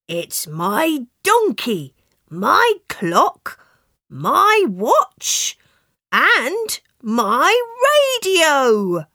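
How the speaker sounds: building delight as each thing comes back; happily. Acted. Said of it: "The farmer said happily".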